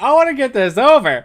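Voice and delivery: loud, impatient demand; impatient shout. Real, not acted. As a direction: blurt it out impatiently.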